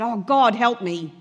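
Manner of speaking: loud, exasperated plea to God; desperate cry for help. Retold or imitated. Frustrated carer crying out to God for help. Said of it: "How many people said".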